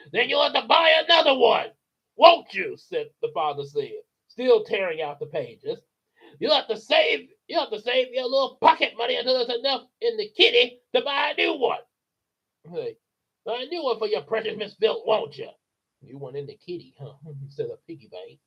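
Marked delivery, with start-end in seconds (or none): 0.1-15.5 much higher mocking sneer; father mocking daughter while tearing the pages. 16.0-17.1 soft little toy voice; quiet piggy bank. Acted.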